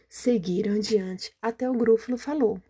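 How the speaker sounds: usual speaking voice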